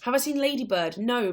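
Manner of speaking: normal talking voice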